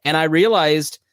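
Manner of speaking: ordinary talk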